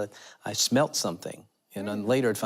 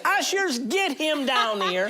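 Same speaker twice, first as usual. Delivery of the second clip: "Ushers, get him down here" much higher and slow, like a commanding preacher; imitating a preacher's command; slower than usual. The preacher calling ushers to bring the boy forward, in a high, slow commanding voice. Same command retold in her voice.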